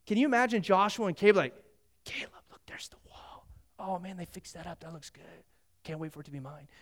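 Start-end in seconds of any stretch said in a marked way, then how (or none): 2.1-6.7 put-on whispered and soft, one old friend to another; hushed whisper. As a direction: lean in and whisper in awe. Joshua whispers to Caleb, arriving.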